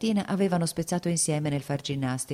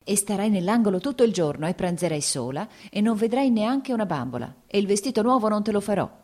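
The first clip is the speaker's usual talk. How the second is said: put-on raised, scolding a child; angry scolding; 6 semitones higher than usual. A narrator's reading. Mother scolding her crying daughter, angry. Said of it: "diceva arrabbiata alla bambina".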